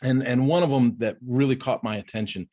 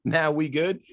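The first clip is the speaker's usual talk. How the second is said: dismissive brush-off; 5 semitones higher than usual. Retold or imitated. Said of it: "when he went".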